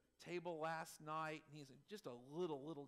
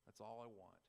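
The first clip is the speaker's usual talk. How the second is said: lower, softening declaration of love; tender, settling softer at the end; tender reunion; 6 semitones lower, 4 dB quieter than usual. Acted. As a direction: say it low and heartfelt to a loved one. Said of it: "you just say".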